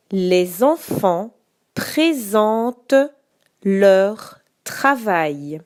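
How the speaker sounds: slow, deliberate sentence dictation. Shown as a demonstration. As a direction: dictate slowly with the punctuation.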